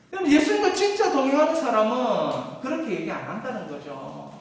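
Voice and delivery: ordinary talk, nothing marked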